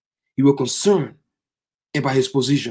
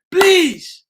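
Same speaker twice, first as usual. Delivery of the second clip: high, loud cry of please; desperate plea; 10 semitones higher, 4 dB louder than usual. Acted. Pilate crying out to the crowd, desperate. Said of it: "He begged them".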